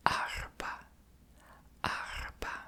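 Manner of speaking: whispered "arba", throaty r, stress on ar; "arba" breathed with an r from the throat; trying a word. Shown as a demonstration. Teaching viewers the Arabic word, throaty r. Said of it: "butchering the r".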